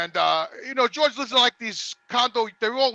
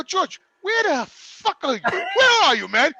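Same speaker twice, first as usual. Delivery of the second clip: exasperated call; 6 semitones higher, slower than usual. Retold or imitated. Phoning friend after waiting hour.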